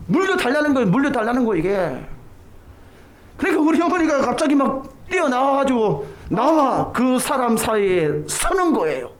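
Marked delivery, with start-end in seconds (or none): none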